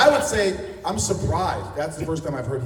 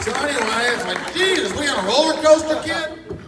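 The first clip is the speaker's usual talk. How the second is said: a higher, louder goofy voice rising to the end; mock impression; 14 semitones higher, 8 dB louder than usual. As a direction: lightly ham up a wacky character. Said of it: "He's like".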